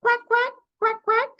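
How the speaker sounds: playful cartoon duck squawking; Donald Duck. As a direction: quack like a cartoon duck, high and loud. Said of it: "How will Donald Duck say it?".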